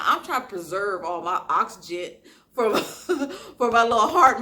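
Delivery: ordinary talk, nothing marked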